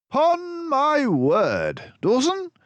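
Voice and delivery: high, loud brisk detective voice; Basil's voice. A narrator's reading. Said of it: "for he said".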